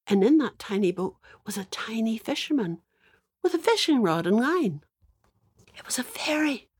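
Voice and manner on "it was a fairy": breathy whisper, pitched up; hushed reveal. A narrator's reading. Storyteller revealing the fairy to children, whispered. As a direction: whisper it like a secret.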